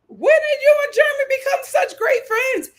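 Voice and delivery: high, loud mocking disbelief; sky-high sarcastic "since when" disbelief; incredulous retort. Real, not acted. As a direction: call out the lie, sarcastically. Host retorting to confessional.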